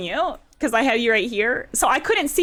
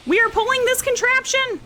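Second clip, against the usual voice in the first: frantic; 13 semitones higher than usual. Real, not acted. Frantic boss fight.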